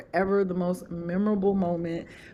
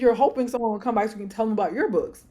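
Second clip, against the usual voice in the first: ordinary delivery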